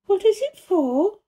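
put-on high, slow curious girl; curious question. A narrator's reading. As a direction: ask it wondering, slowly. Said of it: "she asked curiously".

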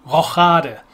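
Rochade said the German way, French sh onset, long a; German pronunciation. Shown as a demonstration. Naming castling in chess in German. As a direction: say Rochade with ro-SHAH-de. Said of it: "this in German is called".

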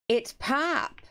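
playful argumentative correction; retold insistent retort. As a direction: insist on it, pitch lifted. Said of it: "used to fight with me".

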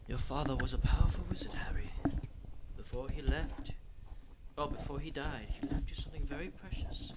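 hushed whisper. Pretend. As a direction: read it under your breath. Rereads the card in a whisper.

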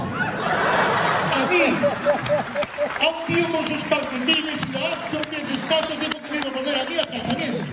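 high-pitched indignant protest; hammed-up wounded indignation; comic outrage. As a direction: rant in disbelief, voice shooting up high. Outraged at being put in treatment.

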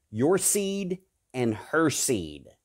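very slow, weighty your seed and her seed; God pronouncing the curse on the snake, slow and solemn; slow solemn pronouncement. Acted. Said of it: "God looks at the snake and he says".

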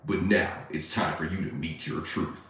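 low, soft menacing voice; mock horror villain. Streamer slips a fake threat into a fan letter, mock villain.

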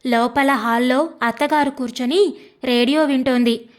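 ordinary delivery

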